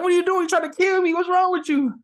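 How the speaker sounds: high, loud furious outburst; boy yelling at the guru after being dunked; outraged yell. Retold or imitated. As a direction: shriek at the guru who nearly drowned you.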